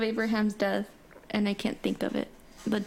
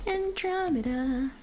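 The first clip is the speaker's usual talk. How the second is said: slow drawn-out answer; 6 semitones higher, much slower than usual. Real, not acted. Answering a quiz question.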